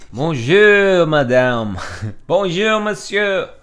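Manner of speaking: high, loud hammed-up French accent; Villanelle. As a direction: belt out an over-the-top French greeting, laughing between. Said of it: "gonna give Villanelle a French accent".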